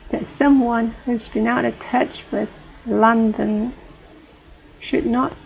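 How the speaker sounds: ordinary talk, nothing marked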